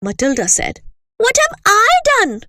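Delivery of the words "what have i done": put-on much higher, louder startled girl; shocked to be singled out; startled child. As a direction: Matilda answering the headmistress, startled. Accused child protests.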